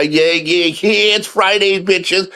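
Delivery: high excited shout-out; hyped up. Real, not acted. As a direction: pump up the crowd. Hyped greeting to viewers.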